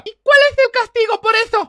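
loud, high scolding demand; stern interrogation. Acted. Mother quizzing the drunk on house rules, angry. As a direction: grill them like a drill sergeant.

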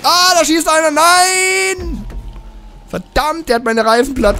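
high, loud racing panic as the tires get shot; yelling in alarm as a shooter hits him; excited outcry. Real, not acted. Gamer shouting to his friend as his tires get shot, excited outcry. Car shot at during race.

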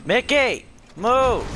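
loud, high call across the room; shouting Mickey's name; calling the dog. Real, not acted. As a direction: yell at the dog to get out of the way.

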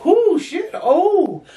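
high on Xanax, overwhelmed whoop; high giddy whoop. Retold or imitated.

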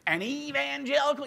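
high, loud scoffing dude voice with a laugh; disdainful dude host. Retold or imitated. Rock DJ mocking the guest's faith with disdain. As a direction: sneer at the born-again. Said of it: "he said it, like, with such disdain".

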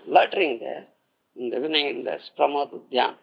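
ordinary talk, nothing marked

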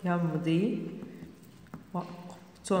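"يمض وقت" word by word, softly, with long gaps; slow dictation. Shown as a demonstration. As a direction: dictate "يمض وقت" quietly, pausing inside "وقت".

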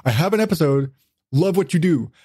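usual speaking voice

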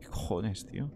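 muttered, annoyed swearing; cursing under breath. Real, not acted. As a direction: curse quietly to yourself. Muttered reaction to game event.

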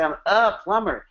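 "a" pushed up high in "I'm a plumber"; stress on A. Shown as a demonstration. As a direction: hit the word "a" high. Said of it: "emphasize the death word "a" like I just did".

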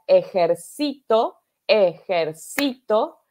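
Ejercito said slowly with SI stretched, ejerCIto; stress on CITO's first syllable. Shown as a demonstration. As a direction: lean on the third syllable of ejercito, e-jer-CI-to. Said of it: "en la sílaba tónica, donde va el énfasis".

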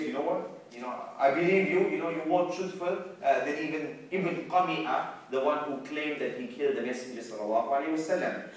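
ordinary talk, nothing marked

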